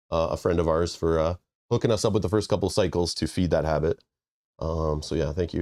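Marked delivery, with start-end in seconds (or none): none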